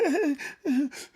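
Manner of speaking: high, stifled mock sobs; imitated sniffling. Boy told to stop crying, mimicked stifled sobs. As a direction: imitate a child choking back crying. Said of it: "And he like".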